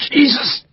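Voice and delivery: high, thin pained cry; wounded major whimpering under the probe; whimpered curse. A narrator's reading. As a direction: whimper it through pain. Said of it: "whimpering the imprecation".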